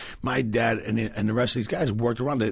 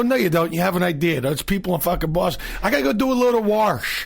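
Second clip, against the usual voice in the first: high, loud Boston voice saying wash as warsh; mock a Bostonian bragging; Boston accent; 8 semitones higher, 4 dB louder than usual. Comic mocking how Bostonians say wash, loud Boston accent.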